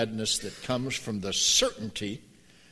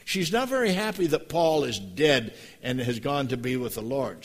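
usual speaking voice

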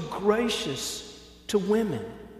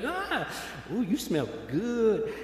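high "ah" sliding into a slow, softer compliment; delighted sigh; 5 semitones higher, 4 dB quieter, slower than usual. Pretend. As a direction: savor the scent, then compliment slowly. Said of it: "and he's like".

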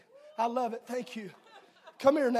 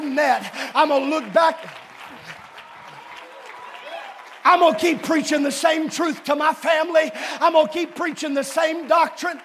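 resolute father; 3 semitones higher, 10 dB louder than usual. Acted. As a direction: proclaim it like a steadfast father. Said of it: "square their shoulders and say".

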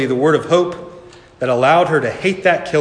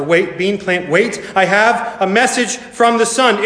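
urgent interruption; 7 semitones higher than usual. A narrator's reading.

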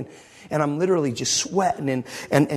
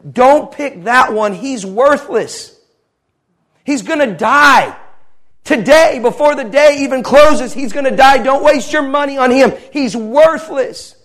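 loud, raised-pitch preaching force, retold; forceful condemnation; 7 semitones higher, 9 dB louder, slower than usual. Dream captor dismisses a dying little boy, loud condemnation. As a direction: pronounce the verdict loudly, drawing out key words.

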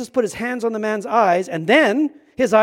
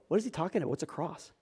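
much softer, thrown-away question; mock puzzled aside; 5 semitones lower, 14 dB quieter, faster than usual. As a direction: drop the volume as if asking a neighbour. Imagined disciples' puzzled reaction.

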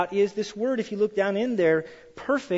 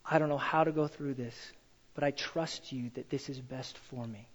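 humble prayer; 5 semitones lower, 8 dB quieter than usual. Retold or imitated. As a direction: pray it quietly and humbly. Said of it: "Instead of going".

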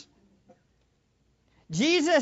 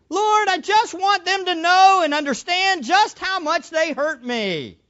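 higher and louder, aggrieved pleading to the Lord; whining hurt prayer; 7 semitones higher, 7 dB louder than usual. Pretend. Preacher mocking how we pray for those who hurt us, loud aggrieved whine. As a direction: pray like someone wronged who wants pity, loud and high. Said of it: "They're more like this".

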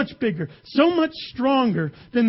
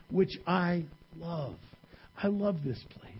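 much softer, earnest confiding; soft sincere; 5 semitones lower, 6 dB quieter than usual. Real, not acted. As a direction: confide it gently to the congregation. Intimate confession of love.

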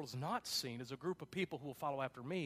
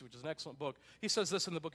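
ordinary talk

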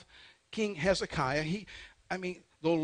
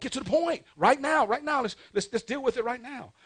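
high, insistent pulpit urging; urgent demand; 7 semitones higher, 4 dB louder than usual. Acted. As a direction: press for it at once, pitched high. Acts out urgent demand for answers.

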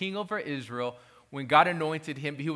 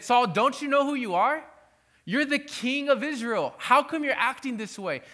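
higher, pleading voice of Samuel; 7 semitones higher than usual. Retold or imitated. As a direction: plead with Saul as a grieved prophet. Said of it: "I could actually see Samuel saying it like this".

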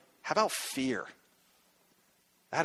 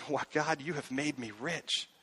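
soft, hushed and amazed, much quieter; awed hush; 9 dB quieter than usual. Retold or imitated. Pastor whispering thanks to God, awed and hushed. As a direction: breathe it out in quiet wonder.

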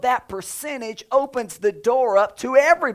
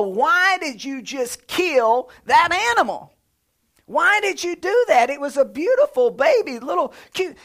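put-on higher, pleading child's voice; upset child; 6 semitones higher than usual. Child asks the parent why the lamb was killed, in a higher, pleading voice. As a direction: ask like a hurt little kid. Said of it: "your kids are gonna wanna know".

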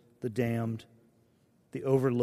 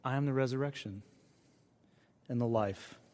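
softer, hushed and gentle; soft whisper-like; 7 dB quieter than usual. Acted. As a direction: say it like a tender whisper. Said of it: "he whispers to us".

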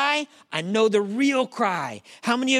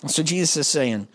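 ordinary talk, nothing marked

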